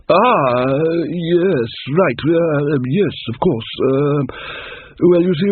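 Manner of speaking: halting, embarrassed king recovering; flustered King Verence. Acted. King covers his mistaken book order, flustered. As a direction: stammer your way to a cover story.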